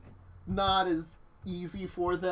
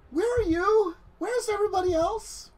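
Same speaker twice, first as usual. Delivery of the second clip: higher character voice; 14 semitones higher, 5 dB louder than usual. Acted. Dawn asking Nathan on the phone where everyone is, high-pitched. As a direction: voice pitched high and a bit louder.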